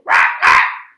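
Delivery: laugh. A narrator's reading. Parrot laughs.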